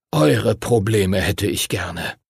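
usual speaking voice

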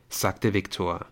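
ordinary delivery, nothing marked

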